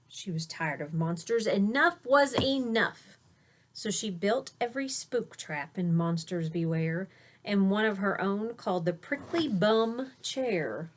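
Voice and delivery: put-on lilting rhyme read to a child; read-aloud storytelling. A narrator's reading. Parent reading a rhyming bedtime book aloud to a child. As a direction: read the verse with a gentle bounce.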